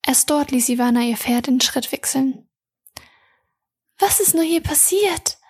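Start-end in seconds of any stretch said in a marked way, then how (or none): 4.0-5.4 a touch of sadness, lightly raised pitch; sad question. A narrator's reading. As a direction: ask it with mild sorrow. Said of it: "fragte Mia traurig".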